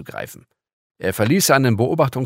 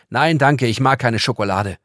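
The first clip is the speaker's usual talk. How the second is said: loud, higher dismissive no; curt refusal; 4 semitones higher, 8 dB louder than usual. Acted. Girl refusing the shiny gift, curt and dismissive. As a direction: brush the offer off curtly.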